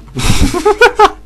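laughter shooting up into a squeal; high squealing laugh. Real, not acted. Laughing at the chaos in the match, squealing.

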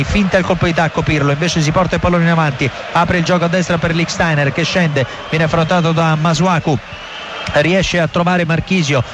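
normal talking voice

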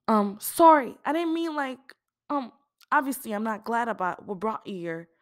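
high, hesitant, fading out; flustered apology. Acted. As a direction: backpedal awkwardly, trailing off. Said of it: "Aisha's voice trailed off".

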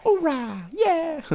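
mock the marine as adorable; cute soldier cheer. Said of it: "it's a cute, it's adorable".

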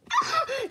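short burst of high-pitched laughter. Acted. Toy finds the prank funny, high laugh.

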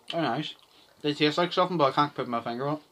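talking between bites; chewing while talking. Real, not acted. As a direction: mouth partly full of snack. Seen 0: Both men eat snack sticks; unclear who speaks, one mid-bite.

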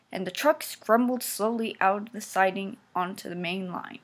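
plain reading voice

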